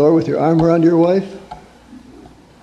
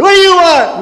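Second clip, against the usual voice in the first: much higher, louder irritated "What do you want?"; grumpy snap; 13 semitones higher, 7 dB louder than usual. Retold or imitated. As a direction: snap at the visitor at the door. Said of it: "Open the door and say".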